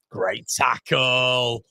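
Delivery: very slow, stretched-out call; drawn-out exclamation. Real, not acted. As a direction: stretch out both words in admiration.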